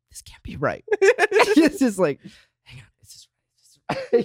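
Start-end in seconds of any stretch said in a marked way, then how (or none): 0.1-0.8 skeptical aside in a whisper; hushed, doubting mutter off the microphone. Acted. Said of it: "like, whisper". 2.6-4.2 barely audible muttering to yourself; hushed, doubtful aside; whispered confusion. Acted. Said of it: "like, whisper".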